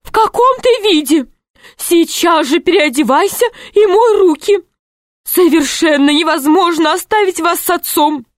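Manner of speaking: high, stern scolding woman; scolding mother. A narrator's reading.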